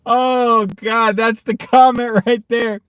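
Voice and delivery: high, strained words through real laughter; dying laughing. Real, not acted. Streamer rereading the winning chat comment, in a laughing fit. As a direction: barely get the comment out for laughing. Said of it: "Oh, I'm dying".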